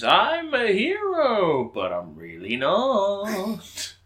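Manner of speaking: mock villain quote.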